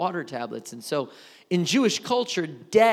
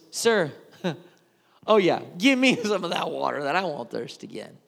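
laughing scornfully through the request; mocking laugh. Woman mocking Jesus's offer of water, laughing sarcastically. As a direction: scoff while asking for the water. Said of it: "Or if it was".

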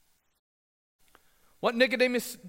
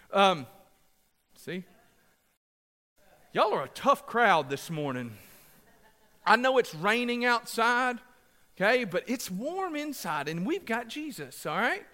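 usual speaking voice

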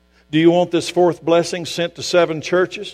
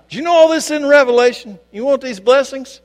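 high, insistent pulpit questions; preaching at full force; 11 semitones higher, 4 dB louder than usual. Real, not acted. Preacher asking the congregation if they want blessings, high and insistent. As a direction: challenge the room with rising excitement.

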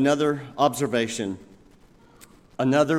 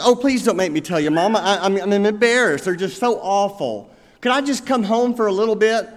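put-on teary young bride; 6 semitones higher, 5 dB louder than usual. A narrator's reading. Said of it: "this young bride just started sobbing".

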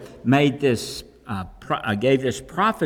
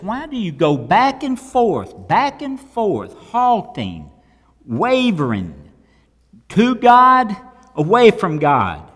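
raised, high-pitched prophet's challenge, building louder; preached challenge; 5 semitones higher, 4 dB louder than usual. Retold or imitated. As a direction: call the waverers out, pitch swinging high, ending loud. Preacher voices prophet challenging the people.

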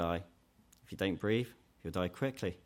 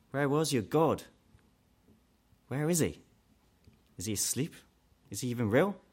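a hint of scornful mockery, building louder; mild mock sneer that grows louder; more mockingly; 3 semitones higher, 4 dB louder than usual. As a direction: taunt the believer, rising to a jeer. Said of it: "Or more mockingly".

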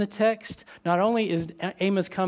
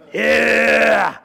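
loud, hammed-up whoop for a brawl; mock cheer; 3 semitones higher, 9 dB louder than usual. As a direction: shout it like a fan egging on a fight. Mock cheer for elbow smash.

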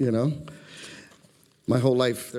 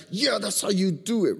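imitated hyped cheer; 7 semitones higher than usual. Imitates players cheering a dunk.

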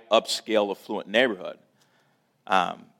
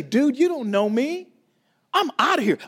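fed-up shout of someone quitting; angry outburst; 13 semitones higher, 6 dB louder than usual. Retold or imitated. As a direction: blow up and walk out. Retold urge to storm out.

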